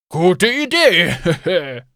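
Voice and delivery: laughing. Acted. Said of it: "lachte Gavin".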